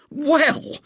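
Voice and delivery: high, gasped outrage; outraged gasp. Acted. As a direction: gasp it in wounded innocence. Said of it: "gasped William in outraged innocence".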